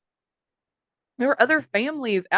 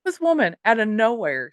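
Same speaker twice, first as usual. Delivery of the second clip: ordinary delivery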